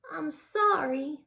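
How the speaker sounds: put-on high little-girl apology; apologetic child. Child Harriet apologises in the story.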